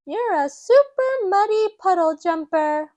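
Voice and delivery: put-on high, loud amazed child; little piglet thrilled by Daddy; excited Peppa. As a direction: cry out in wonder. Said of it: "Peppa cries".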